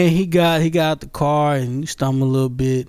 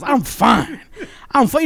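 put-on higher-pitched drunk insistence; mock tipsy protest, pitched up; insistent drunk; 7 semitones higher than usual. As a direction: imitate a drunk repeating himself. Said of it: "drunk people always repeat themselves".

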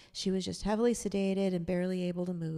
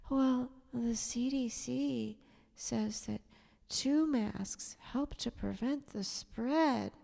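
slow, higher, hushed and frail; whispered, weak reply; 6 semitones higher, slower than usual. Retold or imitated. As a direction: lightly voice a fragile woman in a whisper. Retelling fragile woman's answer.

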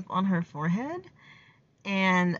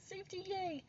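mock cheer; 12 semitones higher, 15 dB quieter than usual. Real, not acted. Mock cheer at own reasoning.

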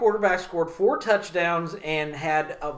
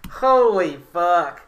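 amazed; 7 dB louder, much slower than usual. Real, not acted. Amazed at the game.

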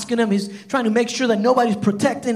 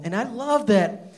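normal talking voice

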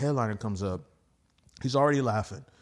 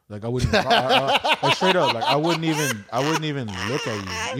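laughing while talking; 2 semitones higher, 7 dB louder than usual. Retold or imitated. Mentor telling the comic he's funny, laughing. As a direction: laugh your way through it.

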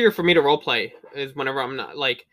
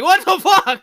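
loud, much higher laughing what the fuck; laughing disbelief; 10 dB louder, slower than usual. Retold or imitated. Player attacked by Gandhi, laughing shriek.

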